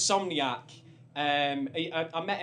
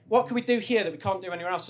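quick, louder, pushy question; Adam's eager question; 4 semitones higher, 6 dB louder, faster than usual. Retold or imitated. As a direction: hurry him along with it, teasing. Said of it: "He goes".

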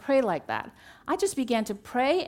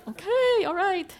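high-pitched, louder comic giving in; mock compliance; 9 semitones higher, 4 dB louder, slower than usual. Daughter pelted with Bible verses by mother, mock compliance. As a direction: give in with a raised, playful voice.

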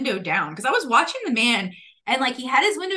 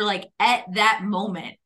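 ordinary talk, nothing marked

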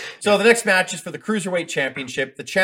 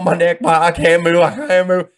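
mock pained cry; 5 dB louder than usual. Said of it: "Bagwell's laying there going".

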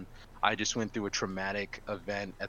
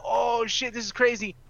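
much higher, amazed exclamation; excited shock; 13 semitones higher, 4 dB louder than usual. Retold or imitated. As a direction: gasp at the fight breaking out. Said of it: "And I'm like".

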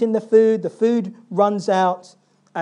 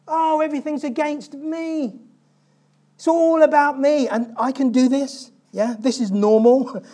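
high whiny mock lament, louder as it goes; self-pity; 5 semitones higher, 4 dB louder than usual. Preacher imitates Jacob's self-pity with a mock whine. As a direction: whine like the whole world is against you. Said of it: "So Jacob is full of self-pity".

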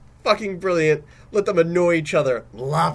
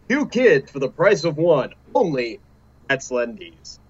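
ad read; 4 dB louder than usual. Acted. Reads a sponsor ad.

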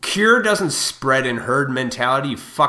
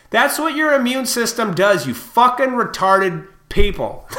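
angry shout; 9 semitones higher, 5 dB louder than usual. Real, not acted. Shouting down the people who doubt immunity, angry. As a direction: yell it at them, spitting the insult.

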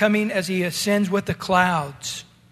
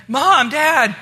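loud, higher call of Mom, Dad; calling out for parents; 6 semitones higher, 6 dB louder than usual. Retold or imitated. Kid home from school calling for his parents, loud call. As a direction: call through the empty house for your parents. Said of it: "he's freaking out going".

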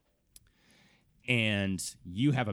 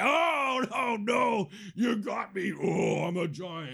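much higher, loud theatrical dying giant; hammed-up mock defeat; 12 semitones higher, 5 dB louder, slower than usual. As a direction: overact being beaten for the kid. Uncle plays along defeated.

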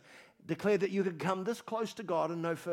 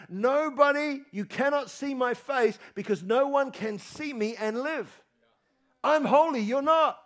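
God declaring, higher and louder; 6 semitones higher, 6 dB louder than usual. Pretend. Preacher voicing God to Moses, higher and louder. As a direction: thunder it as God's holy decree. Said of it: "it says in Exodus thirty-three".

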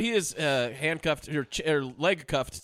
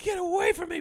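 much higher hammed-up cry; mock damsel cry. High mock cry of the woman in the pool. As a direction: squeal it like a damsel. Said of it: "you see her going like".

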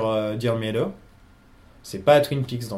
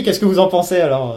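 usual speaking voice

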